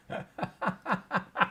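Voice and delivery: high, soft run of laughter; giggling fit. Real, not acted. Host laughing at the small print joke, giggling. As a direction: let the giggles trail out.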